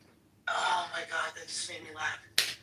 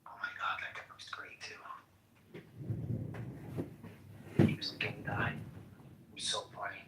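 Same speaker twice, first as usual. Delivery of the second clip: whispered; 10 semitones lower, 7 dB quieter, faster than usual. Real, not acted. Quietly recalling a comedian.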